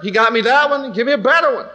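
usual speaking voice

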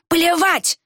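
high, drawn-up furious outburst; angry bellow. A narrator's reading. Villager lunging forward, barking in anger. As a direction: bark it out, pitched high. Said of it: "рявкнул Варх".

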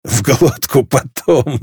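slow, laughing jibe; guffawing. A narrator's reading. Валме laughs at the drunk girl's oath, guffawing. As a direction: crack up over the words. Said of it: "заржал Валме".

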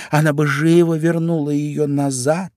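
put-on high girlish voice; confident Alice. A narrator's reading. Alice boasts Dina would fetch mouse, high and confident.

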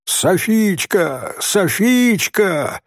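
long, high-pitched repeated call; stretched calling shout, moderate volume; hollering a name. A narrator's reading. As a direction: call as if from a treetop. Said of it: "снова с дерева заорал Нури".

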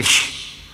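unvoiced hissed shush. Teller shushing another moviegoer, hissed hush. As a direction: hush the heckler with a shh. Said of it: "Y yo le dije".

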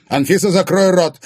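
loud, high-pitched angry shout; barked order. A narrator's reading. Husband ordering Anfisa to be silent, barking. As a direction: shut her up forcefully. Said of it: "неожиданно рявкнул Егор".

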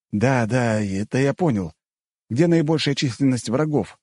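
a touch of impatience; impatient. A narrator's reading. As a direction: cut in, eager for the answer. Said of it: "нетерпеливо спросил я".

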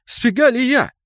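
very high, loud objection; indignant protest. A narrator's reading. Ganker denies the blame to Kraken, high and indignant. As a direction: squawk back in injured innocence.

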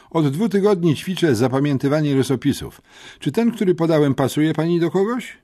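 lekko podniesiony głos rzeczowego chłopca; przejęty uczeń. A narrator's reading. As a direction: report it like an earnest schoolboy witness. (przejęty, rzeczowy uczeń)

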